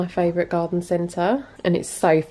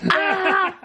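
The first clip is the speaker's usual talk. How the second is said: startled laughing yelp; 13 semitones higher than usual. Real, not acted. Cat attacks hand.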